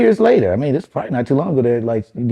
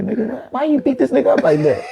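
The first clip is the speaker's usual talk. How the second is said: mock venting cousin; 11 semitones higher than usual.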